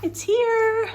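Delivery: very high, hushed squeal; tiny high-pitched squeal of delight; excited squeal. Real, not acted. Vlogger seeing her parcel arrive, squealing softly.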